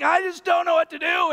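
high, frantic mock panic; high panicky whine. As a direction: whine it like you're totally lost. Panicked self retold.

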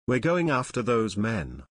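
ordinary delivery

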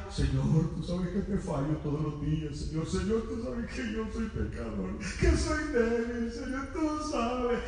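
imitating a tearful, pleading prayer, slowing at the end; whining tearful prayer. Preacher imitates a man crying alone, pleading prayer. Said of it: "Estaba chillando ahí".

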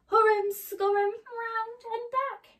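high, loud scurrying chant; excited refrain. A narrator's reading. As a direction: chant it like a frantic little scamper.

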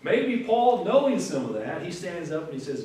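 ordinary delivery

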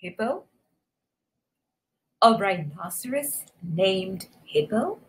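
soft, halting, questioning; quiet disbelief. A narrator's reading. Fluffy hearing rhino's name, in quiet disbelief. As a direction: repeat it softly as it sinks in.

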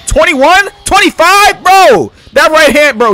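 loud, high amazed; excited. Real, not acted. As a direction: shout the numbers out hyped. Reacting to big damage.

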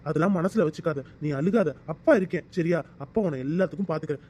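low, consoling fatherly voice; gently steady her, pitch dropped low; tender reassurance. Acted. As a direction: soothe a crying daughter. Father comforts his crying daughter.

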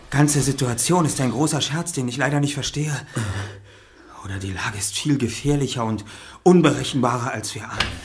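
normal talking voice

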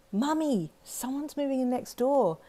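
put-on high, bright little child's voice calling down the hall; four-year-old girl calling. Little girl calls to her mum down the hall, high child's voice. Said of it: "your four-year-old voice rang out".

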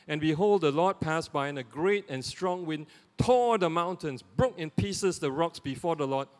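loud, emphatic reading of the passage; scripture reading. Acted. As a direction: proclaim each line like a herald, pausing before the Lord. Reads the Bible passage aloud.

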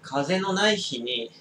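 strained mid-stretch; leaning back, eyes closed; while stretching. Real, not acted. Seen 0: No cigarette in mouth then; he stretches with eyes closed.

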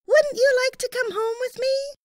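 put-on high, eager young boy; a young boy's high voice; little boy voice. A narrator's reading. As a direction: voice the small boy kindly. Boy's voice in story.

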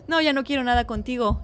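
usual speaking voice

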